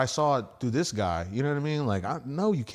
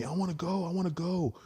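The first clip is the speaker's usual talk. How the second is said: mocking pushy friends; 7 semitones higher than usual. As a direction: mimic friends nagging to come along. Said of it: "everyone bugged me".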